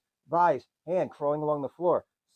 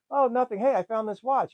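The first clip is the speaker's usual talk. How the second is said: higher, lightly voiced sweet kid; little girl voice; 9 semitones higher, 5 dB louder than usual. Little sister greeting Tom, higher kid voice. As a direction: put on a chirpy kid voice.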